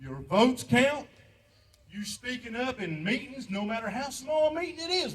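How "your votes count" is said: louder insistence. Real, not acted. Veteran repeating his plea to vote, louder and insistent. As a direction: punch it out louder.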